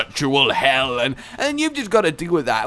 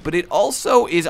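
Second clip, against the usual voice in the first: ordinary talk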